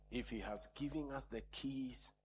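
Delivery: normal talking voice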